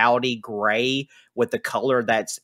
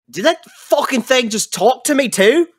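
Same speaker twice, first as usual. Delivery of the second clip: higher, louder startled outburst; shocked NPC; 11 semitones higher, 4 dB louder than usual. Acted. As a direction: blurt out in disbelief. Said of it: "He's like".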